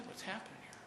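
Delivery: hushed worried quote. Retold or imitated. People are beginning to say.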